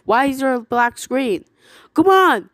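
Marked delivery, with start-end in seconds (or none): none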